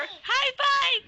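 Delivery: very high excited call; cheerful high five. Real, not acted. Mother cheering the baby for a high five, excited.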